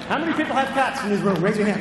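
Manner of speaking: loud, high call to the room; rally the crowd for a show of hands; calling out to audience. Real, not acted. Addressing the audience.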